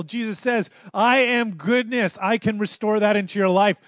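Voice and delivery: loud, high preaching climax; full-force preaching. Acted. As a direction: proclaim it to the back row.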